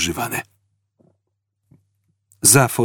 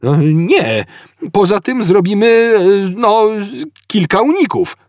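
high, stammering, searching for words; sudden panic; 11 semitones higher, 4 dB louder than usual. A narrator's reading. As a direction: panic creeping up in halting bursts. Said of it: "słychać było nagłą panikę".

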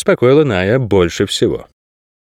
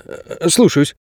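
hesitant, higher submissive reply; meek obedience; 6 semitones higher, much slower than usual. A narrator's reading. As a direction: give in to the boss after a small stumble. Guard submits to boss on phone. (покорно, с запинкой)

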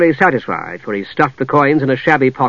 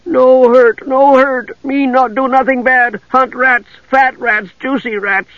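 high, pleading little creature voice; high-pitched grovelling wretch; sniveling creature; 10 semitones higher, slower than usual. Acted. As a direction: snivel and beg in broken speech. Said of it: "The scream dissolved into sniveling".

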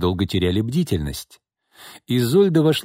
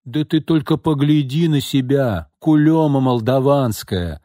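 louder, higher, slow contemptuous scolding; с неприязнью, громко и медленно; with dislike; 6 semitones higher, 4 dB louder than usual. A narrator's reading. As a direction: spit out the insult with disdain. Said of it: "с неприязнью сказала Тамара".

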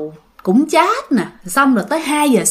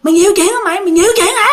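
raised, heated mock scolding that climbs higher; higher-pitched nagging accusation, building; retold scolding; 6 semitones higher, faster than usual. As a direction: imitate a man cussing someone out, repeating it insistently. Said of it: "Ông chửi Thi vậy đó".

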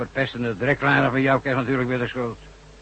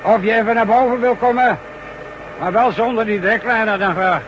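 calling out; 10 semitones higher, 4 dB louder than usual. Acted. Boss repeats call over distance.

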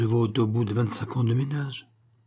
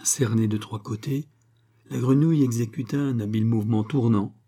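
usual speaking voice

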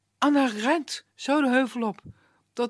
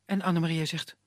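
ordinary delivery, nothing marked

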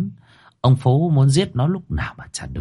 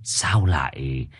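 put-on lower, slow old man's voice; old shaman; 6 semitones lower, slower than usual. A narrator's reading. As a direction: ask slowly like an old village shaman. (giọng ông thầy mo già, trầm và chậm)

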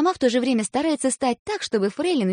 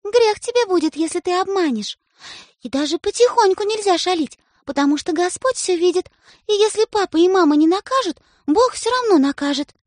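high, louder moralizing; stern warning; 9 semitones higher, 4 dB louder than usual. A narrator's reading. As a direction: threaten him with God. Sister warns of sin.

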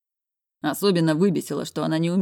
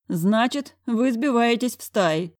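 pensive director; 7 semitones higher, 3 dB louder, slower than usual. A narrator's reading. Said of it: "задумчиво пожевал губами".

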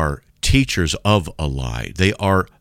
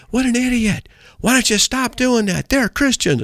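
imitated yelling. Driver heckling a street preacher from his truck, imitated yelling. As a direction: imitate a heckler hollering, pitched way up. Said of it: "He's yelling at me".

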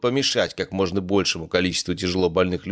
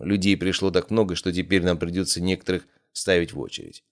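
ordinary delivery, nothing marked

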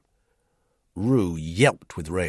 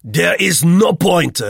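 high, forceful, slow and loud; loud declaration; 11 semitones higher, 12 dB louder, slower than usual. A narrator's reading. Roux rejects Vogel's peacemaking, loudly. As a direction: declare it at full volume. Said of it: "declared Roux loudly".

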